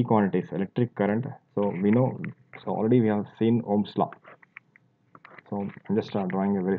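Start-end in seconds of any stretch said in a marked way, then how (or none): none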